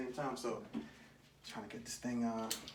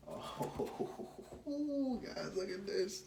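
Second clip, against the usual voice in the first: amazed; 7 semitones higher, 5 dB quieter, much slower than usual. Real, not acted. First sight of new car.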